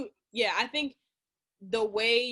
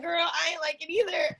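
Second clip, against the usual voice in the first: higher, louder, half-shouted sass; sassy outburst; 8 semitones higher, 4 dB louder than usual. Retold or imitated. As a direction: throw it out like a sassy friend. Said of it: "'cause I was like".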